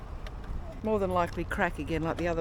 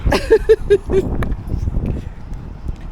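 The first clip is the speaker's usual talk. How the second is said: long staccato high giggle; real laugh; 14 semitones higher, 7 dB louder than usual. Real, not acted. Laughing with companion over eating sushi on the ground, high staccato laugh. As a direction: burst out in a high, rattling laugh. Joking about eating like animals.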